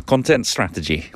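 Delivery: everyday Lancashire voice; Lancashire accent. Shown as a demonstration. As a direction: drop back down into plain Lancashire. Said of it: "I'll stick to my Lancashire".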